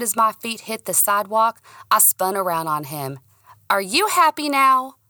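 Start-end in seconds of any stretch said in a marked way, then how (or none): 3.7-5.0 loud, high angry demand. Acted. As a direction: snap the question out. Angry confrontation.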